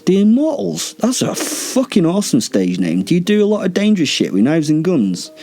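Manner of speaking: high-pitched incredulous fanboy gushing; overexcited, teasing enthusiasm; mock excitement. Mock fan reaction to vampire's boast.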